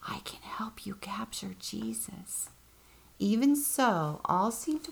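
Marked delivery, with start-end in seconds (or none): 0.0-2.5 soft breathy conspiring whisper. Acted. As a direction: hiss it secretly to the priests. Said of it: "He went to the priests and whispered".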